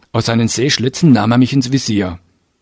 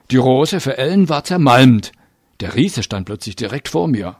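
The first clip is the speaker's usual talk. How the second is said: plain reading voice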